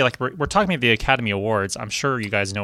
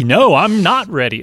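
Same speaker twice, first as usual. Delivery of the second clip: loud, high, drawn-out protest; hammed-up refusal, stretched for comic effect; mock refusal; 7 semitones higher, 8 dB louder than usual. Co-host jokingly answers host's are-you-ready, mock outrage.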